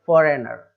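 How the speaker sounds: "फॉरेनर" with no g before the n; silent g. Shown as a demonstration. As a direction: model "फॉरेनर" clearly, g left out. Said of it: "इस तरीके से बोलते हैं".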